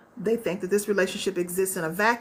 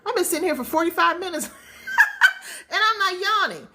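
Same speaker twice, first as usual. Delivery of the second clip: puzzled yawning complaint; 10 semitones higher than usual. Retold or imitated. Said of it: "You're like".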